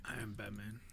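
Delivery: whispered low Batman line; raspy Batman whisper. Pretend. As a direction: hush it like Batman.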